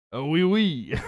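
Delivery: hammed-up Italian-sounding French; mock Italian accent. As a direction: put on a joking Italian accent. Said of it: "why I did the Italian".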